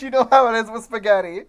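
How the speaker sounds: high, loud, tickled outburst; delighted disbelief. Real, not acted. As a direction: exclaim it in amused amazement. Delighted disbelief at the quoted line.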